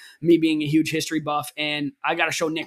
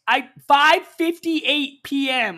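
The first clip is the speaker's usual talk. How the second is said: slow, loud, much higher outrage; outraged emphasis; 7 semitones higher, 5 dB louder, much slower than usual. Real, not acted. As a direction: stretch it out, exasperated. Retelling outrage at lateness.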